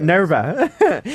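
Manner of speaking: amused Aussie "Narva"; mock Australian accent. Shown as a demonstration. Cohost repeating the station name for laughs, mock Australian accent. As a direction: say "Narva" with broad Australian vowels. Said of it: "how to do an Australian accent".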